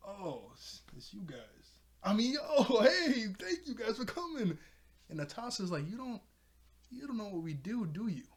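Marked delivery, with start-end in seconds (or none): 0.1-1.8 acted disappointment, trailing away quietly; deflated letdown. Bo sees who actually arrived, quietly let down. 2.0-4.6 high, loud mock welcome; hammed-up cheery recovery; overdone fake cheer. Bo covers his letdown greeting the guests, overly cheery. 5.9-8.2 higher-pitched questioning; acted knowing challenge, pitched up; doubting challenge. Netossa challenges Bo about what they do, doubtfully.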